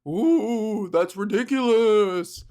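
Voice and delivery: a hint of a slow ghostly moan; drawn-out spooky ghost, only lightly done; lightly voiced ghost. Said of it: "And then the ghost is like".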